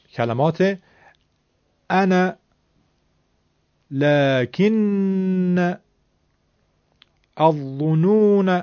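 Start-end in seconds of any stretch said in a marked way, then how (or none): 1.9-8.5 "انا"، "لکن"، "الظنون" each drawn out slowly, ending on a short a; Arabic short fatha ending. Shown as a demonstration. ("انا"، "لکن"، "الظنون" آهسته با فتحهٔ کوتاه)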